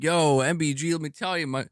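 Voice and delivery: high, gushing imitation of a fan; mocking a fanboy. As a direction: parody an over-keen console fan. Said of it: "You come in here and be like".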